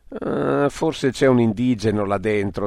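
ordinary delivery

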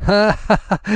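high tittering laugh; amused diners giggling. A narrator's reading. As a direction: laugh it off as a prank show. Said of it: "un coro di risatine".

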